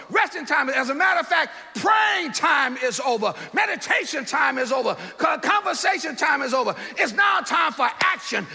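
high urgent shout, rousing sleepers; Jesus waking the disciples. Acted. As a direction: shout them awake, urgent and commanding.

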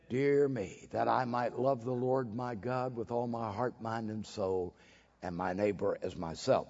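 hushed solemn. Real, not acted. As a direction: let it sink to a near-hush.

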